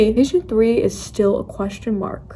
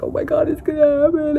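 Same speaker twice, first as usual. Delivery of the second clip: panicked yell; 7 semitones higher than usual. Retold or imitated. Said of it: "I was yelling and whatever".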